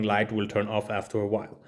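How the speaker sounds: ordinary delivery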